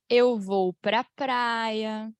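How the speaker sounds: "pra praia" slowly run together; slow linked form. Shown as a demonstration. Teacher showing the everyday linked form, slowly. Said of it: "A gente fala".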